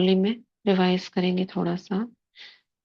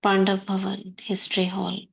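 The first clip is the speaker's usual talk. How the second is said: guided meditation. Acted. Sister guiding the class into soul-consciousness before murli, meditative.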